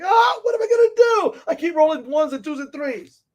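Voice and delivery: high, loud mock wail over bad rolls; frustrated gamer wail. Player mock-wailing over rolling ones and twos. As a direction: whine like a player cursed by the dice. Frustrated dice roller acted out.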